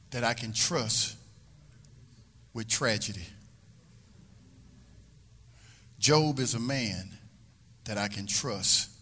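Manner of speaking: preacher slowing down to let "Job is a man" sink in; measured pauses. Acted. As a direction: let each phrase land in silence. Slow measured pauses after climax.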